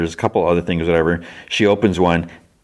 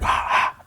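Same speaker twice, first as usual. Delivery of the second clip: two voiceless open-mouthed exhales; imitated breath puffs; 4 dB quieter than usual. He opens his mouth to check his breath, voiceless huffs. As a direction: huff out breath as if testing it.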